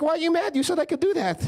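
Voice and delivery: puzzled, as if he did nothing wrong; innocent dad. Retold or imitated. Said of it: "He's like".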